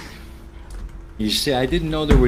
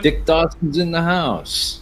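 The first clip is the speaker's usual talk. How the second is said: higher and slower, spelled out for someone who missed it; repeating the name more clearly over a bad line; 7 semitones higher, slower than usual. Real, not acted. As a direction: say it again slowly so he catches it. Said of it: "Say again?".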